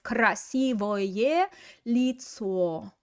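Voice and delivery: Красивое лицо drawn out slowly and clearly; slow model phrase. Shown as a demonstration. Teacher modelling the correct nominative, slow and clear. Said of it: "it should be just nominative".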